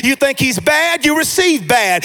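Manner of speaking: driving, emphatic sermon delivery; punchy phrases building in fervor; full-force preaching. Real, not acted. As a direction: preach it to rouse the congregation.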